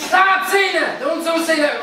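high, loud, drawn-out protest; angry yelling. Acted. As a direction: yell back at your partner's teasing. Gamer snapping back at partner's teasing.

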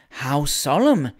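slow, hushed, awed; awed exclamation. A narrator's reading. Miss Jemima Ivins, awed by the organ overture, exclaims slowly. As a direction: breathe it out, impressed by the organ. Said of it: "exclaimed Miss Jemima Ivins".

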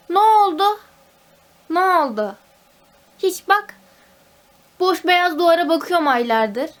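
loud, high frustrated cry; exasperated outburst. Real, not acted. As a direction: vent the frustration at full voice. Frustrated rant about lockdown. (sinirli haykırış)

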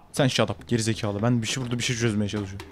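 low, soft annoyed grumble; irritated mutter. Real, not acted. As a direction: grumble it under your breath. Snapping at game voice.

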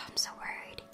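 ordinary delivery

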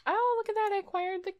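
high, delighted oh; surprised. Real, not acted. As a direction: pitch up in surprise. Excited by a new skill.

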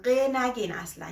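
usual speaking voice

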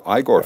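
"Igor" as EYE-gore; movie-style pronunciation. Shown as a demonstration. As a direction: go along with saying "Igor" with an "eye" start. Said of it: "I watched Young Frankenstein".

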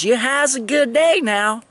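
high, lightly voiced friendly goodbye; imitating her farewell. The sergeant saying goodbye, in a high mock friendly voice. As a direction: send him off like the lady.

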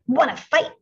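high, loud challenge; grouchy ladybug. A narrator's reading. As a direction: spoil for a fight. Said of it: "said the grouchy ladybug".

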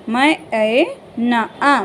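put-on teacher to child, sounding out मैना slowly; each sound of मैना held apart, slowly; slow spelling out. Shown as a demonstration. Spell it out sound by sound, unhurried. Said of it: "ऐसे मैना".

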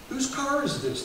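usual speaking voice

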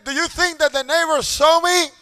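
put-on high, loud pleading child voice; shaking child. A father voicing his son, who begs for notice. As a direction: beg like a little boy shivering in the cold.

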